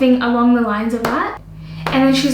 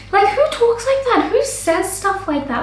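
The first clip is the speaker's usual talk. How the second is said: high-pitched incredulous questions; indignant disbelief; 8 semitones higher than usual. Retold or imitated. Makeup artist fired up over client's rudeness, indignant.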